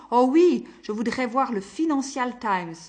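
patient asking urgently for his paper; urgent eagerness. A narrator's reading. Patient begging the nurse for his paper, urgent. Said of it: "comme si la chose était d'une extrême urgence".

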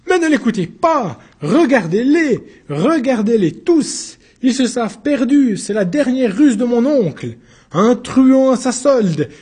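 high, loud desperate outcry; crying out. Acted. Accused springs up to denounce the witness, loud high outcry. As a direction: leap up and shout down the accusation. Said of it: "se dressa d'un vif mouvement et s'écria".

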